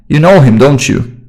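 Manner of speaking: "know" lifted, "don't you" sliding down; falling tag intonation. Shown as a demonstration. Teacher shows it is still a question, falling intonation. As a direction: let "don't you" fall away despite the question mark. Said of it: "the intonation goes down".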